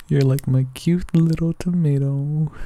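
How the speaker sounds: ordinary delivery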